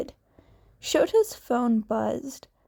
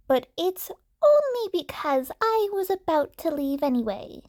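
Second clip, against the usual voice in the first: put-on old nurse on phone; 5 semitones higher, faster than usual. Recovery Girl on phone.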